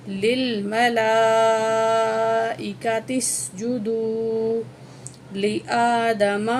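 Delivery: slow, measured recitation; Quranic recitation. Acted. Teacher to students, slow recitation. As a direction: recite it in tajweed style.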